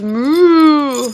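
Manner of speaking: long, drawn-out playful moo; cow moo. Pretend. Mother showing baby what the cow says, playful cow moo. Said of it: "The cow says".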